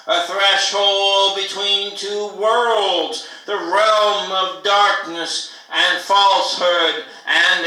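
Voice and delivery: loud, declaimed poetry recital; incantatory poem at full voice; poem reading. Acted. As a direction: project each phrase forcefully, pausing between them. Reads a second poem aloud.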